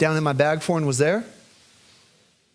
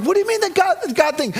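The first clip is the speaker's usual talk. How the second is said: incredulous dad; 13 semitones higher, faster than usual. Retold or imitated. Father baffled by daughter, high incredulous question. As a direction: ask in disbelief.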